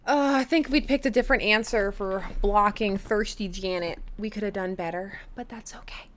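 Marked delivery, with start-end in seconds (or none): 5.3-6.0 a soft whisper to shrug off the result; whispered self-comfort after a so-so grade; whispered reassurance. Real, not acted. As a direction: whisper it, soothing yourself. Reassuring self after mediocre score.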